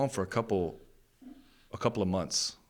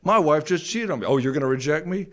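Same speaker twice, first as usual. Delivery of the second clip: louder, higher defiant taunt; 7 semitones higher, 10 dB louder than usual. Retold or imitated. Daring the dating world to reject him, loud and defiant. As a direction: dare them to hurt you.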